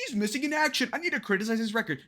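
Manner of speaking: high-pitched whiny politician parody; DeSantis. Retold or imitated. Reactor contrasts candidate with Trump, mocking high whine. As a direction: squeak out the complaint, pitch way up. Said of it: "Whereas DeSantis is like".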